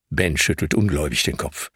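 ordinary delivery, nothing marked

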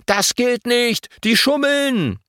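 high, drawn-out protest; storybook outcry at normal volume; indignant calling. A narrator's reading. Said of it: "Sofort rufen die Piraten".

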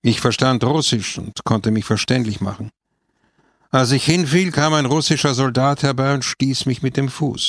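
ordinary delivery

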